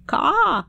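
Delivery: high, loud bird caw; sharp raven's caw; squawk. A narrator's reading. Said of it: "the bird squawked".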